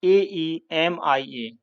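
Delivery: letters read out one by one; deliberate spelling for students; spelling out the word for the class; slow spelling. Shown as a demonstration. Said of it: "वैसे anaemia".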